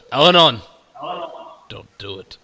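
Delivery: sharp loud call then hush; shouted warning. Acted. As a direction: call his name out sharply. Ferdinand warning Al-Anon.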